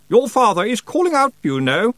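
high, loud, nagging peeve; very peevish. A narrator's reading. Second aunt nags Roland through the door, very peevish. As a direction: scold him peevishly, pitched right up. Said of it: "said another voice with even more peeve".

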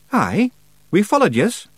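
high, quick Scottish pixie voice; cheeky little Scots warrior; Scottish Feegle voice. A narrator's reading. Rob Anybody answers.

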